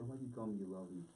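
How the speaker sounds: low, soft seductive plea; intimate coaxing. Acted. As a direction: say it up close, softly working on him. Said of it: "she probably came real close to him".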